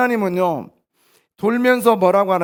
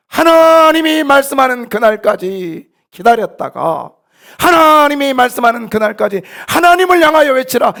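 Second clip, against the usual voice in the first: full-force preaching; 8 semitones higher, 10 dB louder than usual. Real, not acted. Pastor urging believers to wait for God's day, full-force preaching. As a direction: stretch the opening word and cry it out.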